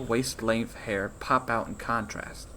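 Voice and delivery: plain reading voice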